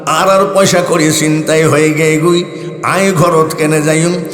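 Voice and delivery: lilting Chittagonian plea, lightly voiced; Chittagonian dialect. Khadem retells the weeping man at the shrine, in Chittagonian. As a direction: mimic the pleading Chittagong madman. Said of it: "ধমক দিয়ে বলছে".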